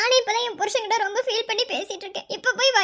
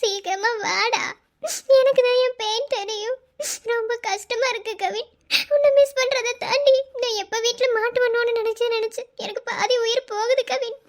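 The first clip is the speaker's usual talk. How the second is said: sobbing between phrases; crying. Acted. Pregnant wife telling husband she cannot cope, crying. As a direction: confide fear and pain through tears. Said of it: "நான் அழுதுட்டே தான் இருப்பேன்".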